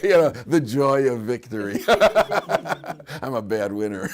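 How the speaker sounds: talking through bursts of laughter; gloat, laughing at your own win; gleeful laughing. Real, not acted. Laughing at his own victory.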